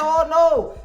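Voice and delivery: shocked gasp. Real, not acted. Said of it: "I said".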